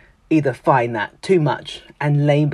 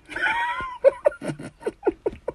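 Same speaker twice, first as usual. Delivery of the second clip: high squealing laugh; 4 dB quieter than usual. Real, not acted. Speaker laughing at her own punchline, high squealing laugh.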